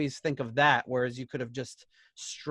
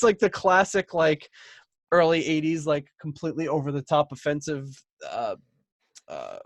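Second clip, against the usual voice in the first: ordinary talk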